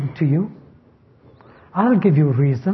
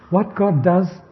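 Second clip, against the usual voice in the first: ordinary talk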